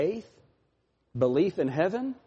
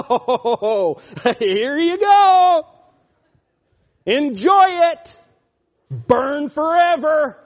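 slow, higher, louder gloating taunt; 8 semitones higher, 9 dB louder, much slower than usual. Acted. Preacher taunting the damned, gloating slowly. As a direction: savour each word like a gloating villain.

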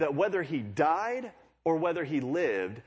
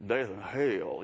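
Texas preacher; 4 semitones lower, much slower than usual. Retold or imitated. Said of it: "but Texas is like".

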